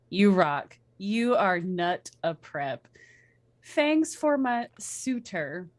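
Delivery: slow deadpan reading. Acted. Reads the fanfic author's note aloud.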